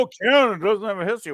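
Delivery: mock quote. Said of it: "So people say".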